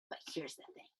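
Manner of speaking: whispered aside, no voice. Acted. Teacher whispers to the class, letting them in on it. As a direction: lean in and whisper it like a secret.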